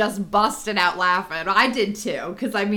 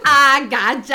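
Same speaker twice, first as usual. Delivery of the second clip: loud, higher mock gloat; triumphant gotcha; 5 semitones higher, 8 dB louder than usual. Mom finally fooling Rich with chicken butt, triumphant.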